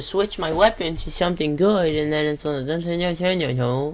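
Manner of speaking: silly put-on voice trailing into gibberish; goofy rambling. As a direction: ramble it goofily, drawing out the end. Goofy garbled rambling while switching weapons.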